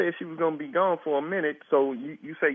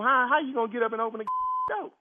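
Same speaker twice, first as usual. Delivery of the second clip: laughing; 7 semitones higher, faster than usual. Real, not acted. Mocking the numb caller.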